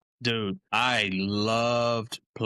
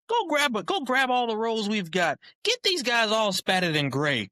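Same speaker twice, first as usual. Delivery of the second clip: high mock coach voice that slows and eases down; Mangino impression; 11 semitones higher than usual. Coach telling the trainer to spat everyone, high mock voice. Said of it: "Your Mangino impression is hilarious".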